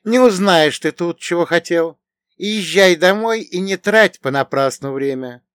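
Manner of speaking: put-on dismissive girl, high and loud; Ветлина. A narrator's reading. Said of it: "произнесла Ветлина". (высокий, громкий девичий голос, твёрдо)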